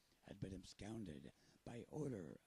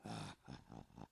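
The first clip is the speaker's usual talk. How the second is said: pirate chuckle; 6 semitones lower than usual. Acted. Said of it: "I sound like a pirate".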